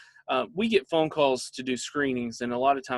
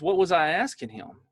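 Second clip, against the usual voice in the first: usual speaking voice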